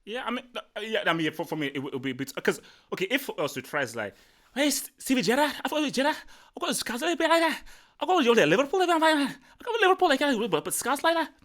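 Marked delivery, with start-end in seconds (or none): 4.6-11.3 put-on high, garbled parody of a Scouse accent with no real words; mock Scouse gibberish. Streamer parodies Scouse for chat, garbled high mock Scouse accent. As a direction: ham up a Scouse accent as pure mumbled sounds. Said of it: "You have to say actual words".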